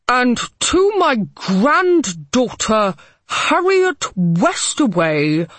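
slow, loud formal will reading; reading aloud. A narrator's reading. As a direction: read out the will, rising in anger. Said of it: "began to read aloud".

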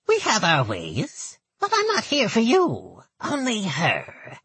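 put-on high, nasal old goblin woman; thick nasal goblin voice. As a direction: pinch it through the nose, goblin. Gertel speaks.

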